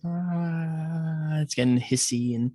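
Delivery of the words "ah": long held high "ahh"; imitated hissing drone. Imitating the sub's all-round sound, a high held drone. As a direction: sustain a high, steady ringing tone. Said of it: "It's like".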